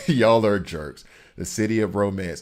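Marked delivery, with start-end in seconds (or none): 0.1-1.1 laughing, slower and louder; laughing jab at Parisians. Real, not acted. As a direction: crack up as you say it.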